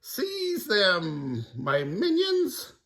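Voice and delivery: high, slow villain order; mummy command. Acted. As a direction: summon the minions.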